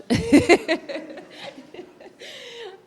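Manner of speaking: high burst of laughter; embarrassed laugh. Real, not acted. Presenter laughing at a stumble while introducing a guest. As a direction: laugh at your own slip.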